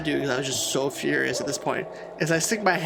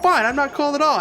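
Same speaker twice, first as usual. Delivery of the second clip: sulky outburst; 9 semitones higher, 4 dB louder than usual. Retold or imitated. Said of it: "I was like".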